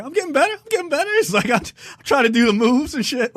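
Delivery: high-pitched excited imitation; mimicking a dancer cheering himself on; John Boyega. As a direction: push the voice way up, playfully. Acting out a dancing John Boyega character.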